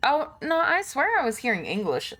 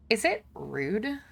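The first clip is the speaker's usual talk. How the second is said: usual speaking voice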